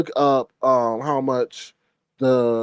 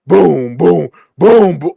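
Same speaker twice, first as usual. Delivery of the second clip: stomping boom; 7 dB louder, slower than usual. Retold or imitated. Mimicking loud footsteps.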